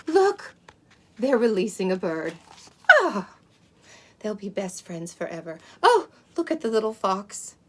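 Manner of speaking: put-on lively read-aloud for small children; storybook reading. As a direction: read it like a bedtime picture book. Reads a picture book to children.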